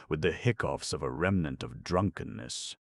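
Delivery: ordinary delivery, nothing marked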